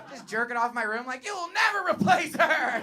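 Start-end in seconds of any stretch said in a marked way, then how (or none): 1.3-2.8 high, loud hammed-up protest; angsty mock outburst. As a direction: yell like a wounded teenage stepson. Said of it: "it makes me too angsty".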